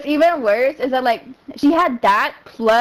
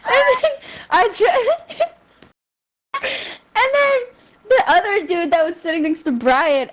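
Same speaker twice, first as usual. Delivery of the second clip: ordinary talk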